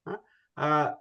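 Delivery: usual speaking voice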